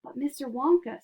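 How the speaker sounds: plain reading voice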